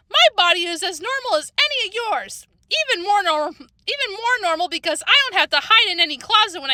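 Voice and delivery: put-on high, heated girl's rant; indignant outburst. A narrator's reading. Girl defends her body to her friends, indignant outburst. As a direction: argue back high-pitched and offended. Shouting match over written insults.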